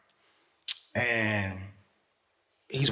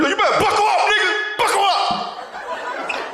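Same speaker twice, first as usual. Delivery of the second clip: very high, strained shout; catchphrase shout; slower than usual. Acted. Cousin hollering his warning, high-pitched shouting. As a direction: yell it way up high.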